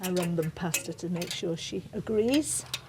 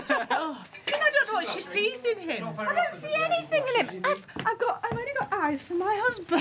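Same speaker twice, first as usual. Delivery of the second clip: high, giggly protest through laughter; squeaky giggling denial of a crush; flustered laughing denial. Real, not acted. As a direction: laugh it off, a bit caught out. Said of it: "The guilty conscience that is".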